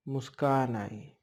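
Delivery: ordinary delivery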